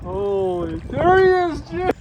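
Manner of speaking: loud, high burst of surprise; excited shout. Real, not acted. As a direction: shout it out as the fish surfaces. Big fish surfaces.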